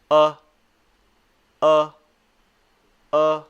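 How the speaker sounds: "uh" loud but loose-jawed; separate, relaxed "uh" with jaw dropped; short U sound. Shown as a demonstration. Teacher showing totally relaxed U, loud isolated "uh". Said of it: "it's totally relaxed".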